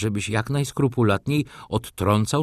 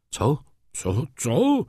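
a rising, ever higher triple "co"; shocked cry; 8 semitones higher, much slower than usual. A narrator's reading. Old father shocked by his daughter's news, crying out. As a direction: cry out in disbelief, climbing. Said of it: "zawołał pan Tomasz".

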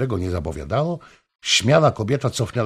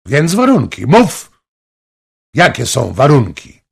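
loud, much higher, urgent; eager demand; 5 semitones higher, 10 dB louder than usual. A narrator's reading. King pressing Anna for her conditions, urgent and loud. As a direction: demand it at once, impatient.

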